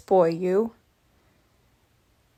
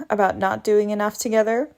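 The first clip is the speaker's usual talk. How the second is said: ordinary talk, nothing marked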